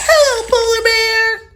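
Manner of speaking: high, bright call across the water; calling a greeting to Polar Bear. Acted. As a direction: hail a friend from afar. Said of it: "Fiona called".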